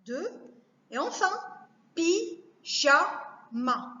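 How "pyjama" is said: "pyjama" very slowly as py... ja... ma; three isolated beats in "pyjama"; long pauses between the syllables of "pyjama"; split into syllables. Shown as a demonstration. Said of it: "couper les mots en syllabes".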